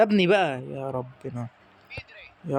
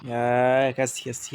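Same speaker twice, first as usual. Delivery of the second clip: drawn-out plea; 4 semitones lower, much slower than usual. Real, not acted. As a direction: stretch out يا كاسياس slowly, long pleading call. Pleading with goalkeeper Casillas. (نداء ممطوط ببطء)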